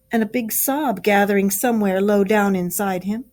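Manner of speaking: usual speaking voice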